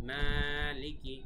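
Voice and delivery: "মালিকি" with the first syllable held long; elongated vowel. Shown as a demonstration. Teacher showing one alif of stretch, elongated "মালিকি". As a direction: draw out the opening vowel of "মালিকি". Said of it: "এখানে এক আলিফ টান".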